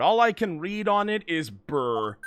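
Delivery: normal talking voice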